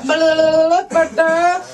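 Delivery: loud rapid foreign gabble; mock gabble. Seller rattling off fast German at the comic, loud gabble. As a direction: gabble it like nonsense chatter. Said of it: "And then she's like".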